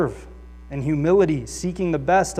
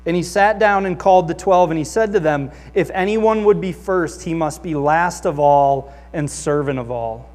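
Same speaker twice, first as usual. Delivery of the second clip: loud, raised scripture reading; 6 dB louder than usual. Acted. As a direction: proclaim the passage to the congregation. Reads scripture aloud.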